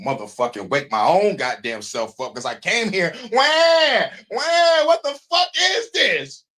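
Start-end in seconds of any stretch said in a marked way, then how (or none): 3.3-4.9 high, loud mock newborn wail; baby wail. Imitating a newborn arriving on earth with a loud wail. 4.9-6.4 slow, high indignant shout; mock baby outrage. Newborn yelling at the world it just landed in.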